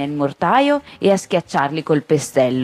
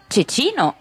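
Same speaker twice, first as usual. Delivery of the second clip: put-on tiny boy's voice; 8 semitones higher than usual. A narrator's reading. Tiny boy names himself.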